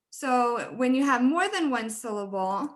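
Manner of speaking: usual speaking voice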